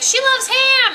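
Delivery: high, loud mock announcement; excited kid. Kid tells mom about fish-girl's food, hammed-up excitement. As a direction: imitate a thrilled little boy yelling news. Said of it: "he's like".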